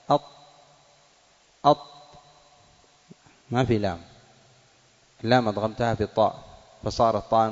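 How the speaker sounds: الطيبات with no lam heard and a doubled ṭa; demonstrating assimilation. Shown as a demonstration. Teacher demonstrating the sun-letter ṭa to the class. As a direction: break off at الط- then say الطيبات with a strong doubled ṭa. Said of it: "مثلًا".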